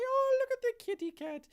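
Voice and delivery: put-on high sing-song baby talk; cutesy high voice. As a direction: coo at the kitty. Voiced quote to cat.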